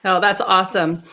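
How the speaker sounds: ordinary talk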